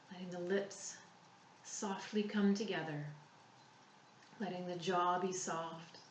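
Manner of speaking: calm, unhurried meditation voice with long pauses; soothing body-scan guide, softening as it goes; guided relaxation. Real, not acted.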